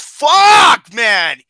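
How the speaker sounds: high, loud exasperated yell; furious shout. Real, not acted. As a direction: vent at the list. Outrage at the ranking.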